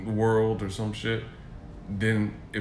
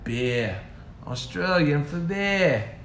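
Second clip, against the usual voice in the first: slow, higher-ending Australian slogan; mock Australian accent; 4 semitones higher, much slower than usual. Teasing with the beer slogan, mock Australian accent. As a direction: stretch it out like a hammed-up Aussie.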